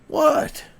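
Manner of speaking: very high, loud put-on dog voice. Owner voicing his dog, very high loud put-on voice.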